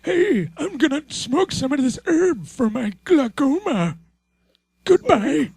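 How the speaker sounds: put-on high old man voice; creaky high old-timer. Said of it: "as old men".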